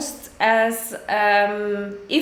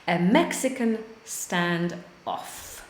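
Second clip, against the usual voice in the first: slow model phrase; 4 semitones lower, 3 dB quieter, slower than usual. Shown as a demonstration.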